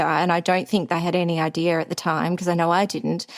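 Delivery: normal talking voice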